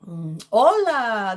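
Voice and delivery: put-on slow, swooping Spanish-accented greeting; Dora the Explorer accent. Streamer finding the cartoon explorer's accent, playful Spanish accent. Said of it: "Dora the Explorer, uh, what do you call it? Uh, accent".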